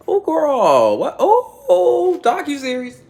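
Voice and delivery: very high, loud squealing ooh; shrieking high ooh over a docuseries; excited squeal. Real, not acted. Squealing over movie choice.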